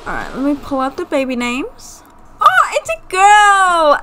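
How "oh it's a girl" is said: high, loud, drawn-out squeal; delighted squeal. Real, not acted. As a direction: cry out happily at the news.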